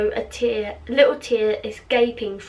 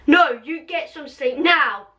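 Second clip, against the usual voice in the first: raised, slow, emphatic order; loud, higher-pitched insistence; shouted; 4 semitones higher than usual. Acted. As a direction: shout it firmly, leaning on each word. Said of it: "Ron shouted loudly at Marley".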